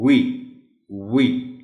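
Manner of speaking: we said with a clear rounded w, not a v; teacher modelling the w sound in we; pronouncing we. Shown as a demonstration. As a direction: round the lips for the w in we. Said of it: "So it will be".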